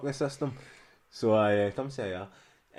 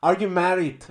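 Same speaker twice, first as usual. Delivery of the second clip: student voice; 8 semitones higher, 12 dB louder than usual. Retold or imitated. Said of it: "she's like".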